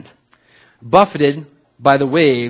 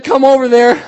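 high, loud, strained acting; clinging Peter; 10 semitones higher, 8 dB louder than usual. Peter hanging on the side of the boat, strained.

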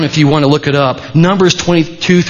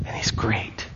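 soft unvoiced mocking whisper; whispered aside; 6 semitones lower, 15 dB quieter than usual. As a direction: breathe it as a sarcastic secret.

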